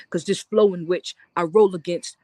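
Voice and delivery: rhythmic spoken-word line; low spoken word line. Acted.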